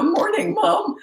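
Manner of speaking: put-on high, sing-song boyish reply; young son chirping back at mom, high; young son's reply. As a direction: answer brightly like a young boy. Young son answering cheerfully.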